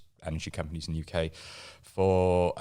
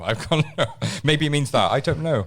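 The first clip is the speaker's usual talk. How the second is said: laughing; 9 semitones higher, 8 dB louder than usual. Real, not acted. Laughing while guessing.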